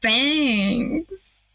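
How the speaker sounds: gasping fan-like thrill; swooning excitement. Acted. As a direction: stretch it out in smitten awe. Gushes over her fangs.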